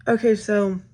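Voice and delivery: ordinary talk, nothing marked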